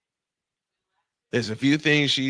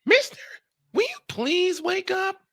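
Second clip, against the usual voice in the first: high squeaky imitation of his wife, much higher; imitating wife. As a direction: mimic her sleepy wake-up plea in a high voice. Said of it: "and she said to me".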